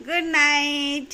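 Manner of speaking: drawn-out singsong goodnight; slow sing-song goodnight. Pretend. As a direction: croon goodnight slowly. Mother saying goodnight for child.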